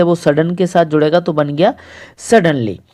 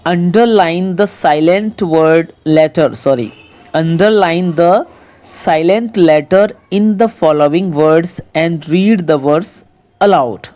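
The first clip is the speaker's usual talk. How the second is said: reads the English instruction very slowly, word by word; slow reading aloud; much slower than usual. Shown as a demonstration. Teacher slowly reading the exercise instruction to pupils. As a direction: spell out the task slowly for pupils.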